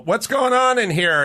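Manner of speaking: high, loud improv entrance line; entering a scene. Retold or imitated. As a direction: burst in asking what's up. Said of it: "I'd go".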